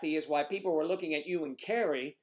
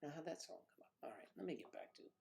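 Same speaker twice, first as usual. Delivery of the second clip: whispered aside; 4 semitones lower, 19 dB quieter, much faster than usual. Real, not acted. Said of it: "muttering to himself".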